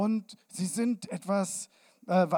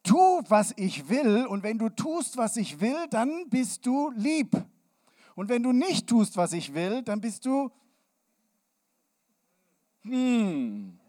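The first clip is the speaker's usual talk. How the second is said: a hint of a controlling grown-up, higher and louder; mock demanding adult; 5 semitones higher, 4 dB louder than usual. Mocking adults who demand obedience from children, lightly voiced. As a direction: play the bossy adult setting conditions. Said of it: "Und was sie meinen".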